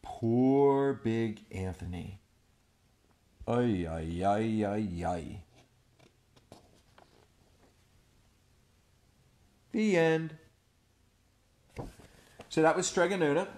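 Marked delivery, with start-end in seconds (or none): 0.0-5.0 mock lament. Pitying lament. 5.0-10.4 put-on lively read-aloud to children; animated storyteller voice; storybook narration. A narrator's reading. Reading picture book aloud.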